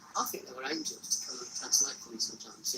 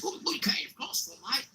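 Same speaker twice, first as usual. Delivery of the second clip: higher-pitched, lightly put-on Australian accent; 8 semitones higher than usual. Curious Australian guy challenges the man with the cross, mock Australian accent. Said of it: "The best Australian accent".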